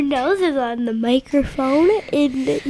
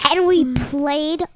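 usual speaking voice

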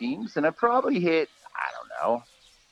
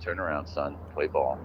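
gruff umpire; 10 semitones lower, 5 dB quieter than usual. Retold or imitated. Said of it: "And the umpire just".

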